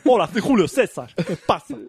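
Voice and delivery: laughing Roman emperor in a mock Cuban accent; playful Cuban-accented emperor greeting; Julio César with a Cuban accent. Joking podcast bit, Caesar greets in a Cuban accent. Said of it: "te lo digo en acento cubano".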